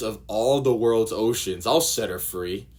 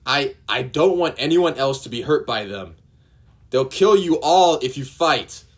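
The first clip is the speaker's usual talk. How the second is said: higher and louder, pleading shout; desperate shout; 6 semitones higher, 9 dB louder than usual. Acted. Nami begging the villagers not to fight, desperate shout. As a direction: shout to stop them from fighting.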